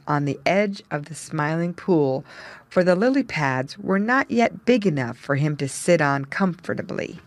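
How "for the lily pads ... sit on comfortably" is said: plain reading voice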